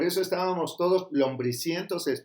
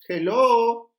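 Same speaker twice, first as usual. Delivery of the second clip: comic hello; 6 semitones higher, 8 dB louder than usual. Acted. Comic punchline after whining bit.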